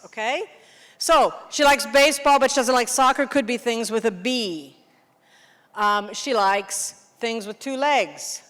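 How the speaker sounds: normal talking voice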